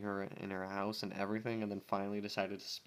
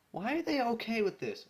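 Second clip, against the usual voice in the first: 1 exasperated disbelief; 12 semitones higher, 4 dB louder than usual. Real, not acted. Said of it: "reader's indignant aside".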